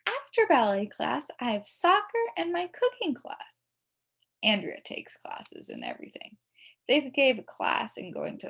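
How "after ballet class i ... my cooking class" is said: put-on prissy girl voice. A narrator's reading. Prissy classmate Andrea answers.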